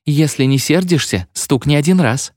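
high imploring request; timid plea to a spirit; pleading. A narrator's reading. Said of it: "взмолилась она".

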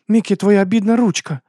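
high, loud sympathetic exclamation; distressed doting woman. A narrator's reading. Abena fusses over injured Miki.

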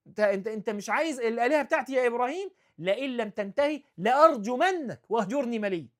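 loud, high and fast angry threat; threatening father. Retold or imitated. Father threatening to stone his son, loud and fast. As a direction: threaten him without mercy.

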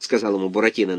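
usual speaking voice

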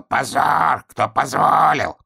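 high, drawn-out scandalised crow; crow shouting. Acted. Crow chases flying hedgehog, indignant cry. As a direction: cry out with long stretched vowels. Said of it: "летела и кричала".

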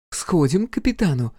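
sly teasing female voice; Viv's voice. Acted. Viv's voice in story.

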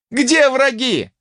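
high, drawn-out battle call; shouted question. A narrator's reading. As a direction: holler it across the square. Fighters answering the alarm. (громкий крик-вопрос)

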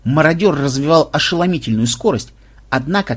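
ordinary delivery, nothing marked

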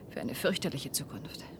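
low, breathy foreboding; dread-filled whisper. Acted. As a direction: voice the horror under breath. Dread about the future.

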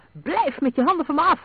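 high, loud outraged shout; furious protest, voice thrown up high; angry roar. A narrator's reading. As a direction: roar it at someone grabbing you. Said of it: "brulde Ross".

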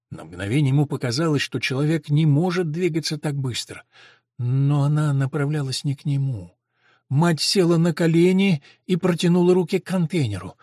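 usual speaking voice

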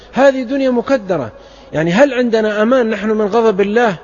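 fervent preacher pleading with listeners; impassioned preaching. Acted. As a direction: press the warning home to the crowd. Preacher warns the congregation.

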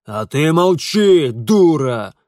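loud, drawn-out snarl of a father; angry bark. A narrator's reading. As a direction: bark her into silence. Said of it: "рявкнул на неё отец".

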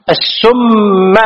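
"السم" drawn out as as-summ; doubled sin and mim. Shown as a demonstration. Teacher spelling out the word, "السم" with heavy s and m. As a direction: slowly hold both the s and m of "السم". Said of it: "بتشديد السين والمي-، والميم".

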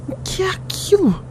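high, startled question; surprised. Acted. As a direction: spots something strange in the sky. Sudden sight of a ship. (surpreso, voz aguda)

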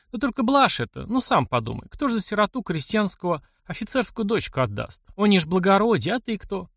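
put-on aunt gently talking sense into a lovesick boy; old aunt Glafira. Aunt tells Mishka an officer's daughter is out of reach, motherly chiding.

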